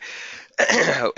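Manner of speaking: a rough, real clearing of the throat. Real, not acted. Webinar host losing his voice clears throat. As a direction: clear a tired, failing voice. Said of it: "Excuse me, losing my voice here".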